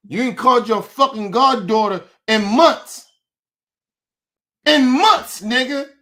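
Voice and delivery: real furious outburst; angry shout. Real, not acted. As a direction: yell it in disbelief. Angry rant at friend.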